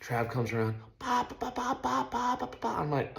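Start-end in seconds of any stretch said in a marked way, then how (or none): 1.0-2.7 high staccato imitated pelting; rapid ba-ba rock impacts. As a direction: pop out each hit like gunfire. Imitating rocks hitting rig.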